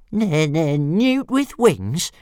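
high, slow sing-song mimicry; mocking dragon voice. Dragon mocking the bully's insult, high and slow. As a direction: draw out the taunt like a cheeky little dragon. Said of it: "repeated Toothless".

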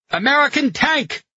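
high-pitched shouted warning; alarmed cry. A narrator's reading. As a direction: call out the sighting in high alarm. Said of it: "Lyers cried".

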